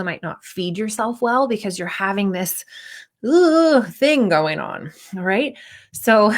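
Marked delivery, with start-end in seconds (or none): 3.2-3.8 high, loud groan of dread; uneasy "ugh" noise. Acted. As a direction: imitate a queasy, dreading shudder. Imitates the uneasy feeling before practice.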